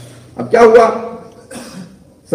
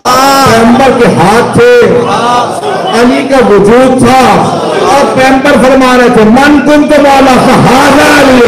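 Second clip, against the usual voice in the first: preaching proclamation; 3 semitones higher, 5 dB louder than usual. Acted. Companion proclaims Ghadir to the crowd, building to full force. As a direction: build from firm declaration to high, loud cry.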